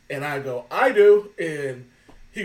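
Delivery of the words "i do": bold, loud volunteer; loud call-out. Retold or imitated. Answering the host from the audience, loud proud shout. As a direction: shout it out proudly from the crowd, higher. Volunteering loudly at burlesque show.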